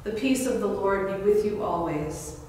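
usual speaking voice

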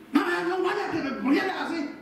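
high, sharp mock scolding with a laugh; harsh scolding parent. Parent refusing a child's sleepover, mock harsh scolding. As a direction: play the parent who loses patience, half-joking. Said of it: "con un carácter".

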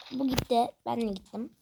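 ordinary talk

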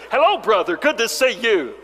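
a light touch of a glad-handing preacher; lightly voiced hearty preacher; preacher impression. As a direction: greet like a jovial preacher. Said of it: "preachers ... walking up to you".

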